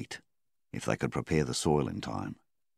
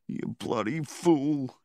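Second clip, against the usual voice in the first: slow, higher tearful plea; whimper; 11 semitones higher, slower than usual. A narrator's reading. Flynn grieving over Milvan, slow high whimper. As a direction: let the voice crack with grief. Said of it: "he whimpered".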